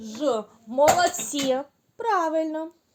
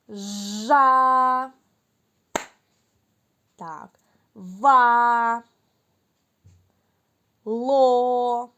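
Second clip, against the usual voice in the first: each syllable held and louder; syllables called; 4 dB louder, much slower than usual. Shown as a demonstration. Teacher calls syllables for listening game. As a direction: sound out each syllable long and clear. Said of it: "Треба почути склад".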